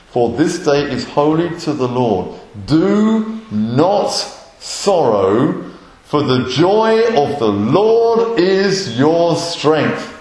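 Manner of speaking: loud, rising proclamation, slowing down on the last line; proclaiming scripture. Acted. Preacher booming the verse to the congregation, loud and slow. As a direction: declare the verse to the congregation, each word weighty.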